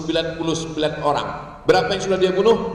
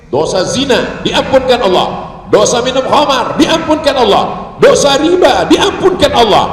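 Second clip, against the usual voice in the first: rising louder and higher with each item; building proclamation; 6 semitones higher, 8 dB louder than usual. Real, not acted. Preacher assures crowd sins are forgiven, building shout.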